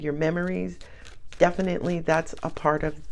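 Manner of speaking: ordinary talk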